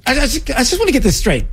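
high, fast, stammering incredulity; exasperated disbelief. Real, not acted. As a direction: blurt it out, barely believing it. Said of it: "I can't play anymore".

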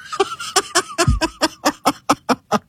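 real laugh, way up high. Real, not acted. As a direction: crack up at the repeat. Laughing again at the AI.

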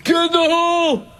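high, showy fan holler; mock golf-heckler yell. Co-host mimics a fan showing off to his buddies, mock yell. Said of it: "anybody that yells".

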